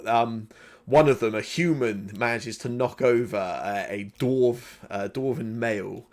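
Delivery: ordinary talk, nothing marked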